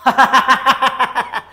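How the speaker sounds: big hearty burst of laughter; a full belly laugh; 1 loud laugh. Shown as a demonstration. Demonstrates laughter yoga.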